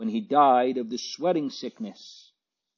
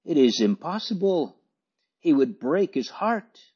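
higher-pitched, mildly dismayed protest; narrated objection, pitched up; stammered protest; 7 semitones higher than usual. A narrator's reading. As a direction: protest in a raised pitch, in short separate phrases. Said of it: "he stammered".

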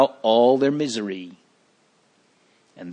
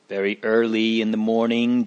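ordinary delivery

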